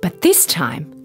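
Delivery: ordinary delivery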